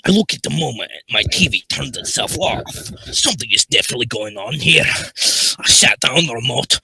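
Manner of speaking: story read in a loud ogre voice; Shrek. Acted. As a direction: ogre impression, starting at a shout and easing off. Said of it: "we're not doing the Shrek voice".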